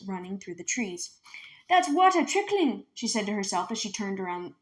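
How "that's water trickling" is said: excited discovery. Acted. Minley hears water in forest.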